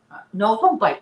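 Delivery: loud, jumpy don't bite; startled no. Real, not acted. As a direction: yelp a quick no at the biting puppy. Dog nibbling her fingers.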